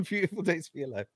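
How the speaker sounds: fast, laughing through it; laughing while saying it. Real, not acted. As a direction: giggle the words out. Laughing repeat of catchphrase.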